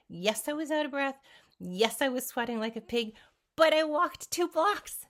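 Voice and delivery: building to a loud, high proud cry; triumphant. Real, not acted. To viewers, recalling her first walk, triumphant and loud. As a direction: belt it out, proud of every block.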